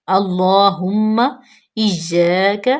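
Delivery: drawn-out recitation, very slow and clear. Shown as a demonstration. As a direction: stretch each syllable like teaching recitation. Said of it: "teacher models recitation". (بہت آہستہ تلاوت)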